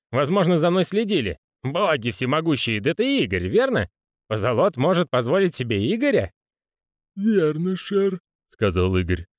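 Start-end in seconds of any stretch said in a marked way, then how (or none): 0.1-6.3 jittery, slurring clerk voice; a hint of a tipsy panicked man; drunk nervous clerk. 7.1-8.2 high creaky servant greeting; a lisping manservant voice; creaking groaning door voice. Acted.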